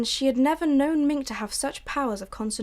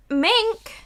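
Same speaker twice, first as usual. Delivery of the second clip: loud call across the yard; 10 semitones higher, 7 dB louder than usual. Acted. Mother calling her daughter back, loud.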